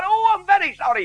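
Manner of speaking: pitched well up, a sheepish sorry; apologetic foreman. Retold or imitated.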